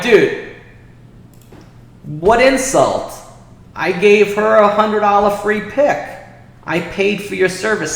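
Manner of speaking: high-pitched, indignant retelling; indignant retort. Retold or imitated. Streamer retells his reply to a customer's complaint, indignant.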